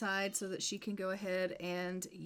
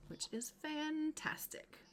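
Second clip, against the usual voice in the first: slow, pitched-up whisper; whispered aside; 8 semitones higher, 5 dB quieter, slower than usual. Real, not acted. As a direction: whisper it, a little sarcastic.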